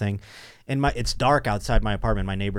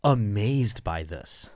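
slowed mock-hyped plea; slow hyped imitation; much slower than usual. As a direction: drag it out like an overexcited believer. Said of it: "She is like".

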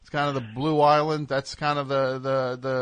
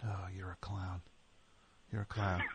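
low, very soft dismissal; disgusted aside; 6 semitones lower, 15 dB quieter than usual. Real, not acted. Host dismissing co-host after spoiled joke, quiet disgust. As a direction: write them off under your breath.

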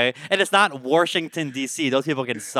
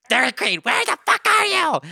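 much higher, shrieking call out; mock yelling call; 3 dB louder than usual. Friend's video calling out to Dairy Queen, shrieking. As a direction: shriek the name across the bar. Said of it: "you were like".

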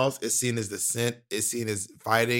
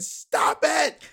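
high-pitched mock yell; acted outburst, pitched way up; Tyra yelling. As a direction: shriek it like a fed-up TV host. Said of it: "when she starts yelling".

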